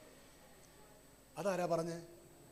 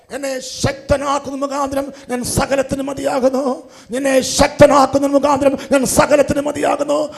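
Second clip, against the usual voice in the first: louder and louder, slow, high shouted climax; preaching shout; 5 semitones higher, 16 dB louder than usual. Real, not acted. Preacher drives the verse into the church, building to a roaring shout. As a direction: build the verse into a full-force shouted proclamation.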